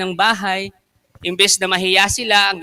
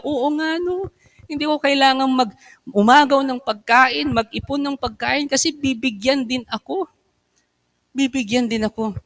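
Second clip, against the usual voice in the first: much softer, higher, sheepish murmur; hushed, embarrassed admission, voice pitched up; ashamed realization; 8 semitones higher, 5 dB quieter than usual. Acted. Ashamed guests quietly admitting their mistake, hushed and high.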